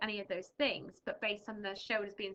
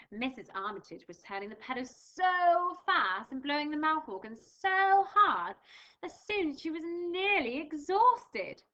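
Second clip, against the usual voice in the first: louder, animated storybook reading; reading to children; 3 semitones higher, 6 dB louder than usual. Acted. Teacher reading Missus Armitage to pupils, animated read-aloud. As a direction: bring the story alive for young listeners.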